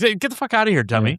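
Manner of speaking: high-pitched mock dismissal, falling on the last word; imitating blunt boss. Boss sending his brewer away, mock dismissive. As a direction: playfully imitate the boss shooing someone off. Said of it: "that's how he talks to you".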